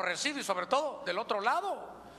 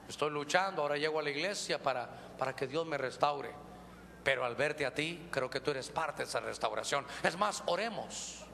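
low, soft smarmy come-on; lower, quieter pious seduction line; a married man sweet-talking a single woman; smooth married man; 7 semitones lower, 3 dB quieter than usual. Acted.

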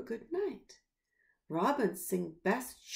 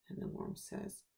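usual speaking voice

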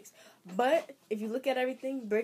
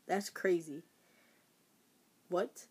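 normal talking voice

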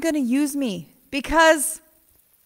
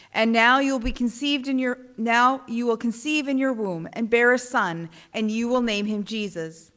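measured, formal gospel reading; scripture reading; 4 semitones lower, faster than usual. Acted. Reading the gospel aloud to the congregation, solemn and measured. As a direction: read the lesson to the congregation.

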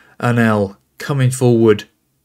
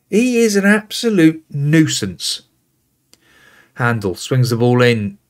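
ordinary talk, nothing marked

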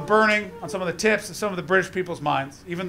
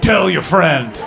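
deadpan threat; 6 dB louder, much slower than usual. Retold or imitated. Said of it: "just said".

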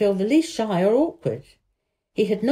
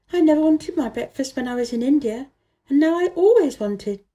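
a higher, lightly voiced young girl; girl's voice; 6 semitones higher than usual. A narrator's reading. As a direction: read Mary as an eager little girl, pitched up. Mary voiced in a story.

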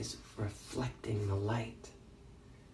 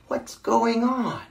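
high, loud, drawn-out call; 11 semitones higher, 6 dB louder, slower than usual. A narrator's reading. Person looking out the window, calling out.

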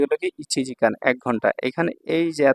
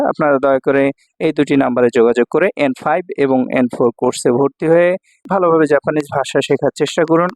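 louder, announcer-style ad read; promo read; 5 dB louder than usual. Acted. Teacher advertising his course to viewers, announcer style. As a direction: read out the contact details like an ad.

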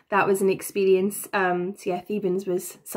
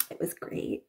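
whispered, much softer deadpan aside; sarcastic whisper; 14 dB quieter than usual. Real, not acted. After nearly breaking Trip's neck, a whispered sarcastic aside. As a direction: breathe it out as a dry joke.

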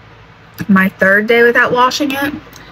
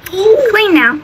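put-on baby talk; 12 semitones higher than usual. Mom talking to toddler son.

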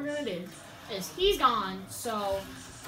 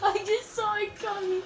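laughing; 7 semitones higher than usual. Real, not acted. Laughing after death.

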